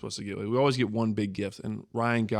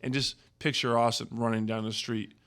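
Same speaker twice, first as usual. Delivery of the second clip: ordinary delivery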